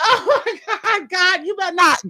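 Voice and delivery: high, laughing oh my God; laughing alarm. Retold or imitated. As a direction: laugh out the warning. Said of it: "I'm like".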